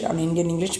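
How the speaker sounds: ordinary talk, nothing marked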